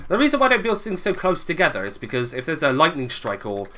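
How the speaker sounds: ordinary talk, nothing marked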